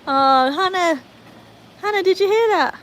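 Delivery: loud, high pet-talk call; gushing to dog. Real, not acted. Owner telling golden retriever Hannah, gushing call. Calls dog about favourite breed.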